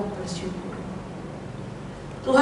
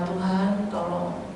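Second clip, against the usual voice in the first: soft, lower retold prayer; retold quiet plea; 3 semitones lower, 7 dB quieter, much slower than usual. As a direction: quietly recall a simple plea to God. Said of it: "saya cuma bilang". (doa pelan yang diceritakan kembali)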